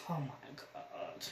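slow, soft whispered oh my God; a barely voiced sad whisper; whispered grief. Real, not acted. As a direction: breathe it out in sorrow. Grief over cousin's death.

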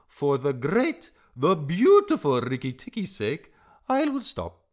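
high, loud flattering bird; grand singsong declaration far up in pitch; fawning, theatrical little bird; Darzee. A narrator's reading.

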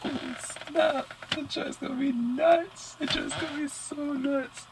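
high-pitched, thrilled exclamation; excited. Real, not acted. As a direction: squeal it with delight. Excited reaction to trailer.

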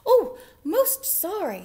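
high, slow, loud squeaky apology; apologetic mouse. A narrator's reading.

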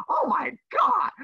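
high, slow, gasping oh my God; hammed-up disbelief; teacher faking shock at a student comment; mock shock.

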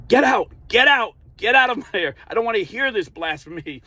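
high, loud mock yell, easing off at the end; angry yell. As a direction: yell them out in an outraged, strained high voice. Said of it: "he yelled at him and said".

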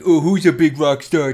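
hammed-up teasing praise; retold silly cooing praise. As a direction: imitate someone making goofy, loud small talk. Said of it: "fucking dumb shit, like".

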